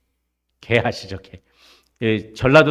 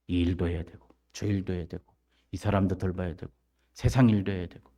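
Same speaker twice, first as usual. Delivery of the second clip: low, quiet list of chores; muttered excuses; 5 semitones lower, 7 dB quieter than usual. Retold or imitated. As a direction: mimic a busy person's excuses under the breath. Mimics excuses of busy people.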